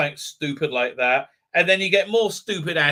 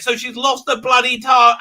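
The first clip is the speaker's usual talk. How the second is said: ordinary delivery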